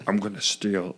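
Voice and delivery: low, soft whispery impression; barely voiced movie line; Nick Cage. Learner copying the Cage line, hushed and low. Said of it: "just say this line as Nick Cage".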